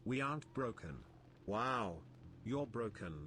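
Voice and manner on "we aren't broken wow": ordinary delivery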